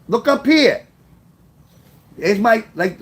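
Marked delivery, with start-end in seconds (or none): 0.1-0.9 mock woman. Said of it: "like women tell you when you're looking at their titties".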